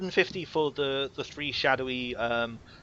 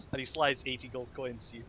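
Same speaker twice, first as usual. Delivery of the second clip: ordinary talk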